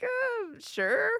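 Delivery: soft, high uh sliding into a doubtful yes; hesitant agreement. Retold or imitated. Customer unsure before a pushy vanilla seller, hesitant. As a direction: agree reluctantly, quietly, with a questioning rise.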